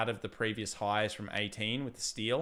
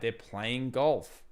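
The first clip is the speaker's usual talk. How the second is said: ordinary delivery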